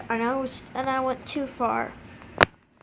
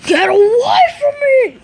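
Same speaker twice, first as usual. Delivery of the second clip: high, loud, frantic scream; furious shout; 11 semitones higher, 12 dB louder than usual. Acted. Acted shout in a quarrel.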